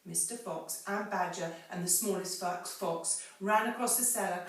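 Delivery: ordinary talk, nothing marked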